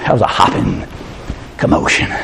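hushed, unvoiced punchline; whisper. Preacher whispering the punchline to the church. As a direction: drop to a whisper for effect. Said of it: "whispered punchline".

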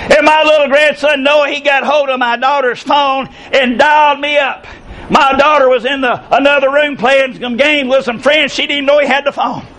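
normal talking voice

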